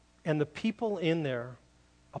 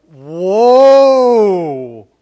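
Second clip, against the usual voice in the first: loud, amazed cry of delight; Adam's thrilled exclamation at first sight of the woman; excited whoa; 4 semitones higher, 20 dB louder than usual. Acted. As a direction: shout it out like you can't believe your eyes. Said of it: "genuine excitement".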